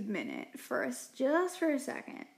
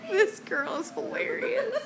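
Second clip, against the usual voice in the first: laughing; 8 semitones higher than usual. Real, not acted. Helpless laughter.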